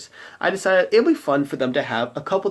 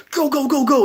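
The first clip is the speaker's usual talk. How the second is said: high, urgent urging on; rally the party to chase, pitched up; 13 semitones higher, 5 dB louder than usual. Retold or imitated. Players rush after the boy.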